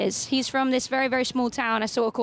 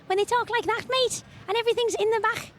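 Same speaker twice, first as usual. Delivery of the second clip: high-pitched Liverpudlian, back in the throat; Scouse accent; 10 semitones higher than usual. Shown as a demonstration. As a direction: imitate a Scouser in a much higher voice. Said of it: "Have you heard the Liverpudlian accent?".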